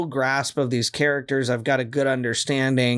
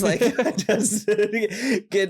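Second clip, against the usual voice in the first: laughing through the words, pitched up; 6 semitones higher than usual. Real, not acted. Laughing as she begs to hear about other characters. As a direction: giggle out the plea.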